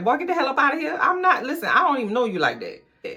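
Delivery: high, incredulous bartender brushing him off; exasperated bartender. Retold or imitated. Bartender refusing a broke student, high and incredulous. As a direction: shoo the broke kid away, squeaking with disbelief.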